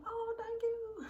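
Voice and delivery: very high, slow, squealy "Oh, thank you"; gushing thank you. Retold or imitated. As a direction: gush like a delighted friend. Imitating her gushing reply.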